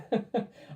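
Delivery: loud, high burst of laughter. Real, not acted. A loud high laugh after giving up on the Kiwi accent. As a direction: crack up at your own joke.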